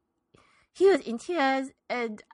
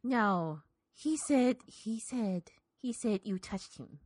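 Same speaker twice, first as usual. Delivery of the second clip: softer, lower, halting; hushed accusation; 4 semitones lower, 7 dB quieter than usual. Acted. Old woman to the reverend, hushed and halting. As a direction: struggle to get the words out.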